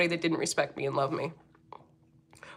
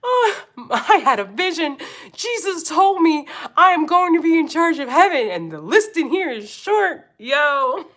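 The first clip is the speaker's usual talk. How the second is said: a hint of a preachy Karen, higher; lightly voiced entitled Karen with a vision; playfully imitating a self-important Karen; mock Karen; 7 semitones higher than usual. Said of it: "She goes".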